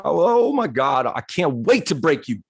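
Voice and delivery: retold gleeful excitement. Husband racing to catch his wife out on camera, excited. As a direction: relish the coming win over an opponent. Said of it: "I couldn't contain my excitement".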